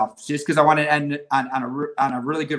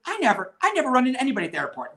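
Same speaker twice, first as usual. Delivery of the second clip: fast, higher, jealous inner grumble; a hint of envy, rushed and pitched up; 7 semitones higher, faster than usual. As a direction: mutter it to yourself in envy. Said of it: "this is in my head".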